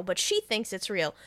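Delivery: ordinary delivery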